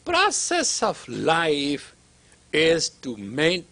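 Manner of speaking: each word drawn out and weighty; slow deliberate teaching stress; slow emphasis. Shown as a demonstration. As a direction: lay out "Process of life" slowly, word by word. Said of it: "all right, listen to this".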